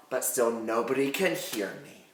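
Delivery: low and very soft; suspenseful hush. Real, not acted. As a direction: sink down quietly for suspense. Hushed suspense in her story.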